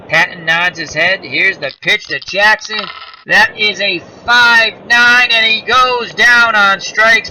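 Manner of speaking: high, loud building game-ending call; play-by-play call. Acted. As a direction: build to the last strikeout call. Calls the final out.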